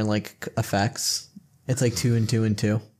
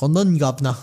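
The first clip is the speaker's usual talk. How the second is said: a hint of London accent; mock British accent; 3 semitones higher, 3 dB louder than usual. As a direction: toss off a hint of cockney "governor". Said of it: "meanwhile, he's like".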